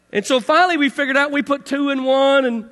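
ordinary talk

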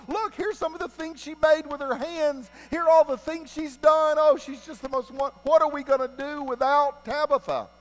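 hammed-up wail, pitched very high; high-pitched, over-the-top mourners' lament; mock crying. Mourners showing what Tabitha made, mock crying. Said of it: "People are crying".

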